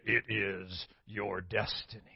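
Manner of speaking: a self-mocking Darth Vader; bad Darth Vader impression, deep and breathy. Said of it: "the Darth Vader voice".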